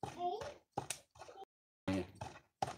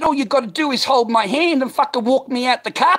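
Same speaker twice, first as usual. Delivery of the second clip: very high, full-volume yell; reenacted enraged shouting, pitch way up; retold fight challenge; 13 dB louder than usual. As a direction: scream the dare at him. Retold climax of confrontation.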